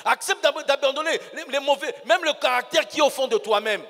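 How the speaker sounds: loud, driving sermon exhortation; preaching at full force. Acted. Preacher urges the congregation to drop bad habits, loud and forceful. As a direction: hammer it home to the congregation.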